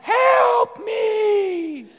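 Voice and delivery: very high, loud mock wail; hammed-up cry for help. Mom begging for help around the house, hammed-up shout. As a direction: holler it as a long, desperate mock plea. Acted plea of an overworked mom.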